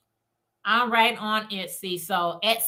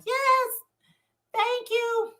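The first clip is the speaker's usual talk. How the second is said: much higher delighted "Yes, thank you"; excited squeal; 13 semitones higher, slower than usual. Retold or imitated. Seller thanks a customer for an order, squealing. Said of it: "I get so excited".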